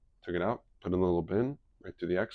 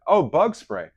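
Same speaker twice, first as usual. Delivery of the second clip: higher, louder oh of realisation; realising it was the bug spray, higher and louder; sudden realisation; 8 semitones higher, 9 dB louder, slower than usual. Retold or imitated. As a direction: it dawns on him. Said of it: "sudden realisation".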